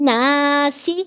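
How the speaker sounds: لناسی with a light, thin lam, said slowly. Shown as a demonstration. Teacher showing students the thin lam in لناسی, slow and light. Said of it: "لام کو باریک".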